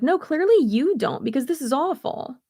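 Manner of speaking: exasperated retort. Retold or imitated. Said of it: "And it's like".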